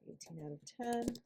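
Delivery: quick whispered rating; whispered score. Real, not acted. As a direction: say the number under your breath.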